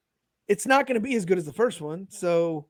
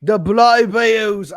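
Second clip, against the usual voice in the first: loud, higher-pitched mock Cockney; Cockney accent; 5 semitones higher, 8 dB louder than usual. Echoing co-host's impression, louder mock Cockney accent. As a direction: echo it in a hammed-up British accent. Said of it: "I think that's a little Cockney".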